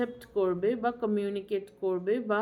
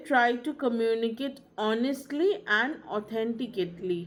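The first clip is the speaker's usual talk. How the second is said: slow maxim; much slower than usual. Shown as a demonstration. Teacher stating a key maxim.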